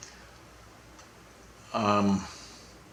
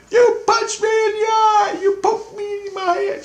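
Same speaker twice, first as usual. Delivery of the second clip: Julie screaming; 4 dB louder than usual. Retold or imitated. Retelling a kid screaming about a poked eye, shrieking. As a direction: imitate an outraged, wailing scream. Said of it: "Julie's screaming at me".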